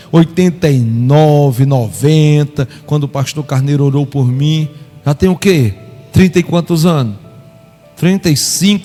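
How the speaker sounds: ordinary delivery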